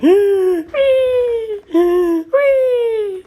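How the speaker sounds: high squealing gasp snore, falling away; funny snore. Retold or imitated. Imitating another of Norman's funny snores. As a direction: let out a silly wheezing squeal. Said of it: "other times it's like".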